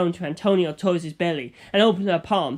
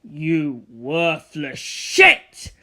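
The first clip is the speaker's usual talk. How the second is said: low, slow, louder snarl of contempt; Dio screaming; 3 semitones lower, 4 dB louder, much slower than usual. Acted. Dio screaming at failing Antonio. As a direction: spit the insult out venomously. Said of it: "Dio screamed".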